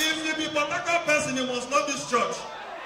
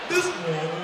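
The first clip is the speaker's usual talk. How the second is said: much lower but full-voiced pastor call; booming pastor voice; 8 semitones lower than usual. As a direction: boom it low like a pulpit preacher. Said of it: "which church when the pastor they sound like this".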